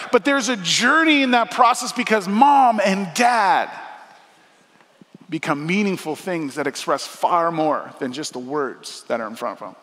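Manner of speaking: ordinary talk, nothing marked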